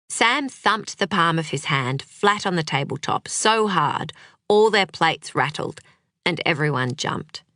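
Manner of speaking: ordinary delivery, nothing marked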